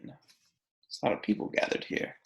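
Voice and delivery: hushed whisper to the class; soft whispered side comment; whispered aside. Real, not acted. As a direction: lean in and whisper it. Teacher aside during read-aloud.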